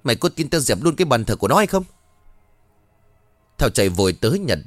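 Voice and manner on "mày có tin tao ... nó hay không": high, harsh scolding shout; shrill furious stepmother tirade; angry stepmother yelling. Acted. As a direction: berate the girl furiously. Stepmother screaming at stepdaughter.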